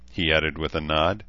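ordinary delivery

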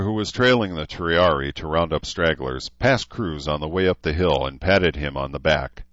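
ordinary delivery

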